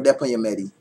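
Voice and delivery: quick Georgetown Guyanese Creole warning; Georgetown accent. Shown as a demonstration. Demonstrating Guyanese dialect for viewers, Georgetown accent. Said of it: "say something in a Guyanese dialect".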